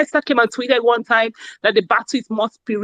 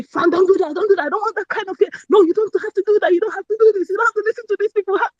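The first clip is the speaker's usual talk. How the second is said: a friend scolding, pitched higher and quick by the end; 8 semitones higher, much faster than usual. Retold or imitated. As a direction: playfully imitate a friend telling you off. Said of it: "scolding me".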